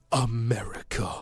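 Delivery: low, drawn-out whispery parody; hushed, hammed-up patriotic slogan; mock patriotism. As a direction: intone it slowly with sham reverence. Mocking the film's patriotism.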